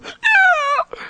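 very high, tearful little squeak; overwhelmed squeal. Retold or imitated. Drive-in girl answers the praying man, overwhelmed squeal. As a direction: imitate an overcome girl squeaking agreement.

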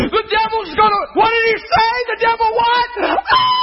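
very high-pitched shrieking questions; mock panic. Preacher imitating believers scared by devil's threat, mock panic. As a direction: work up into a hammed-up scream. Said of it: "Do you see what we do?".

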